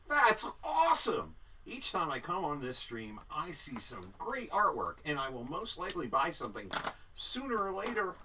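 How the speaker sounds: excited. Pretend. Reading chat message excitedly.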